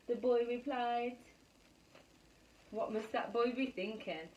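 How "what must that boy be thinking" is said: usual speaking voice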